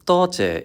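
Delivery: "toche" said high, falling in Cantonese tone. Shown as a demonstration. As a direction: start "toche" high and let it fall. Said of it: "is comprised of the words".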